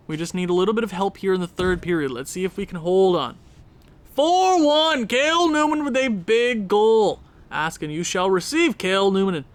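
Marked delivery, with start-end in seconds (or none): 4.1-7.2 high, loud, drawn-out goal shout; excited goal call. Real, not acted. Calling a big goal.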